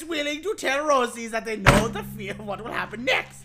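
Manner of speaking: shouted synopsis in a hammed-up German accent; loud German accent. Host shouting over his co-host in a mock German accent. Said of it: "the German accent?".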